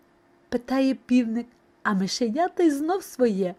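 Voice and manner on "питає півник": usual speaking voice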